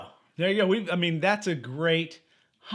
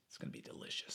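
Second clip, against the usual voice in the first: whisper; 21 dB quieter, faster than usual. Real, not acted. Savoring imagined pizza.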